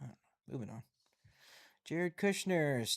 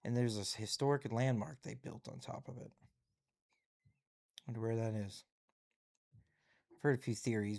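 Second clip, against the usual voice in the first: ordinary talk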